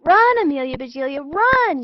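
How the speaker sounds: high, loud urgent yell. Acted. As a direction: urge her on from the bench. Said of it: "yelled the boys".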